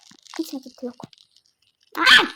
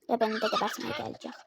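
whisper; 3 semitones lower, faster than usual. Real, not acted. [name] uyanmış, fısıltıyla korkuyor.

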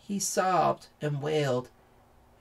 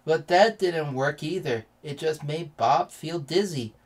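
usual speaking voice

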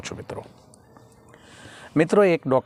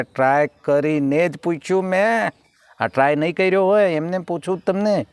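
exasperated retort; 6 semitones higher than usual. Retold or imitated.

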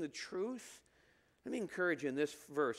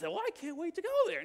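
sarcastic; 11 semitones higher, 4 dB louder than usual. Retold or imitated. Mock sarcastic onlooker.

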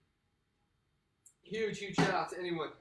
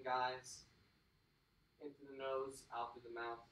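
calm breathing guide; 4 semitones lower, 5 dB quieter than usual. Acted. Coach guiding the cool-down breathing, soft and calm. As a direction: ease them into the stretch.

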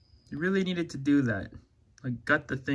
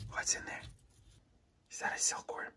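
soft conspiratorial whisper to a pet; excited whisper; 4 semitones higher, 9 dB quieter than usual. Pretend. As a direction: gasp then a hushed, secret whisper. Teasing lizard with hidden food.

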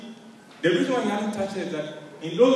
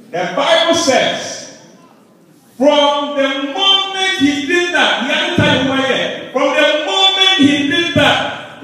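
loud, higher, slow hammering sermon; full-force preaching; 8 semitones higher, 9 dB louder, slower than usual. Real, not acted. Preacher proclaiming scripture to the congregation at full force. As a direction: drive each phrase home to the church.

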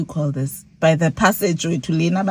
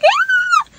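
high-pitched mock scream; re-enacted scream; 4 dB louder than usual. Passenger screaming as she fights for her phone, re-enacted scream. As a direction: let out a high squealed imitation scream. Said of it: "I start screaming".

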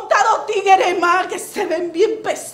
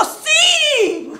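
eager high yes; 8 semitones higher than usual. Acted. Acted quote, eager yes to man.

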